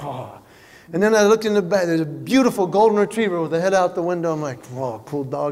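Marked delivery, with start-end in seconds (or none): 0.0-0.4 low, soft breathy oh; quietly awed; impressed oh. Retold or imitated. Dad quietly admiring a truck at a red light, low and soft. 4.7-5.5 low, soft admiring whoa; murmured admiration; quiet awe. Retold or imitated. Dad murmuring admiration at the dog, low and soft.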